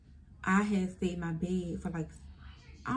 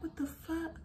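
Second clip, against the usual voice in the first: confused, high and soft; dazed on the ground, high confused squeak; high bewildered what the fuck; 10 semitones higher, 8 dB quieter, slower than usual. Retold or imitated. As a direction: squeak it out in disbelief. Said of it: "I'm like".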